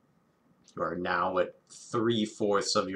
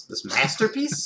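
offended tone; 5 semitones higher, 5 dB louder than usual. Pretend. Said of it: "my offended tone".

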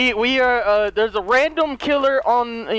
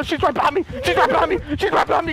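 higher-pitched, urgent chase panic; panicked calling; 7 semitones higher than usual. Real, not acted. Player to teammates while being chased, panicked. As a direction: call out as the killer closes in.

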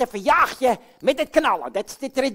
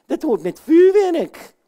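insisting; 6 semitones higher, 6 dB louder, slower than usual. Acted. Puppet insists on fireworks.

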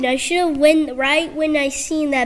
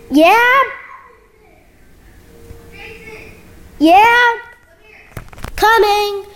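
high, loud call across the house; 8 semitones higher, 4 dB louder, much slower than usual. Real, not acted. Answering a call from offscreen, loud high call. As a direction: shout back to someone in another room.

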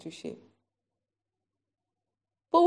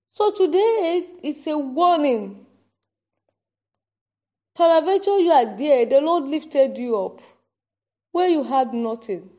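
loud, high prophetic warning; 4 semitones higher, 7 dB louder than usual. Acted. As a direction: proclaim it like an Old Testament prophet. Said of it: "I'm talking like a prophet now".

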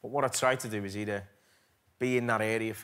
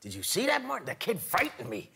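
higher, laughing De Niro impression; 7 semitones higher, 4 dB louder than usual. Actor retelling De Niro to Scorsese after the take, amused. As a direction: play the shaken star praising the kid. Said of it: "and he went".

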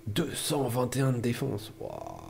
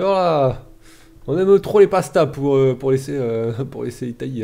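loud, high, drawn-out mock Englishman; 4 semitones higher, 12 dB louder than usual. The streamer mocking the English AI in a loud high voice. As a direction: ham up the English saying they love pasta too much. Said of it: "Ils ont fait".

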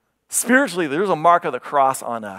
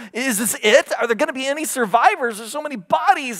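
anxious wondering; 10 semitones higher than usual. Retold or imitated. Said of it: "he wonders out loud to God".

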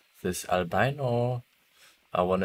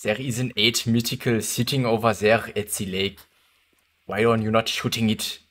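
mock German accent; 2 semitones higher than usual. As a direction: play a jokey German accent on request. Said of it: "Can you speak in a really German accent?".

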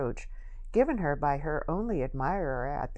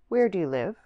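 abrupt, louder and higher, almost rude; blunt question; 4 semitones higher, 7 dB louder than usual. A narrator's reading. Young man bluntly asking the girl where she lives, abrupt. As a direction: ask it straight out, bordering on rude. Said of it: "direct to rudeness".

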